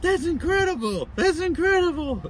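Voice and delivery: high, loud awestruck exclamation; bewildered and excited. Retold or imitated. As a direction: marvel at it, dumbfounded. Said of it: "so, like, bewildered and excited".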